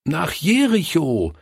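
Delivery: put-on high, eager shout of children; children calling out. A narrator's reading. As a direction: call out the answer like eager kids. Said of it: "riefen sie".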